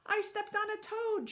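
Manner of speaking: high, disgusted cry; shouted. Acted. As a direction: yelp it in disgust. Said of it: "shouted somebody else".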